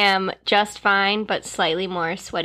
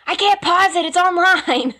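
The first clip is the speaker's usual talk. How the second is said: raised whiny gamer voice; yelling across the room; 8 semitones higher, 5 dB louder than usual. Retold or imitated. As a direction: shout back from the game. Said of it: "those things where it's like".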